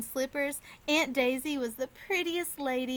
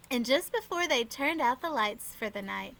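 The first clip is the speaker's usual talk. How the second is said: plain reading voice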